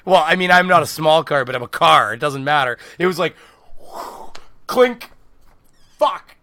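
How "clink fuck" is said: sudden shout. Retold or imitated. Engine dies, swearing.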